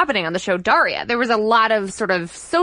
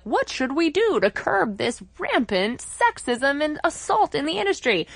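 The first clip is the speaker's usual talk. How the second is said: higher-pitched mock-earnest question; lightly mocking, raised pitch; mock puzzlement; 6 semitones higher, 4 dB quieter than usual. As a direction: imitate people feigning helpless wondering. Mimics people puzzling over sexism.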